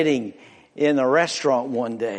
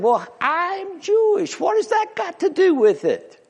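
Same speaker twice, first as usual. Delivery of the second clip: mock Chinese man; 13 semitones higher than usual. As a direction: play the puzzled foreigner. Joke, punchline setup.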